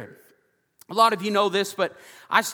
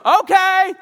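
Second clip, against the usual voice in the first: very high, loud comic yell; retold holler; 14 semitones higher, 12 dB louder than usual. Hard-of-hearing veteran answering the lady through the door, imitated yell. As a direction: holler the reply, imitating a deaf old man.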